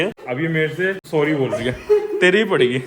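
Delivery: usual speaking voice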